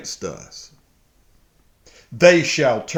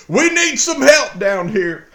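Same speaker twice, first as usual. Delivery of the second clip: urgent call for help; 8 dB louder than usual. Acted. Continues the acted plea.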